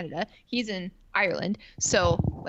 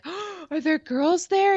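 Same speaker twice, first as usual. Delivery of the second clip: high, slow suspicious whine; jealous whine; 10 semitones higher, 4 dB louder, slower than usual. Retold or imitated. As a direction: whine it like a jealous girlfriend, pitched way up. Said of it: "And I'd be like".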